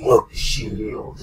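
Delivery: whispered through clenched teeth; through gritted teeth. Retold or imitated. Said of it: "talks through his teeth".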